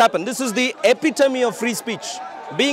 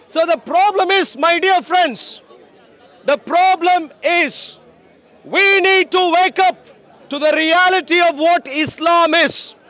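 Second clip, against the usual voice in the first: street preaching; 6 semitones higher than usual. Acted.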